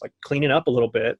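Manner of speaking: normal talking voice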